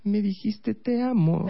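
high put-on female voice; lovestruck girlfriend. Girlfriend answering boyfriend mid-battle, mock lovestruck. Girlfriend's clueless sentimental reply.

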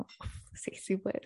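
soft, breathy yes; breathy whisper. Retold or imitated. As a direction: whisper it, turned on. Aroused reply to a kiss request. (susurro excitado)

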